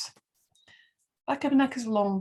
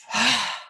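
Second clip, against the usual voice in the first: audible exhale. Shown as a demonstration. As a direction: breathe out audibly through the mouth. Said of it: "out through the mouth".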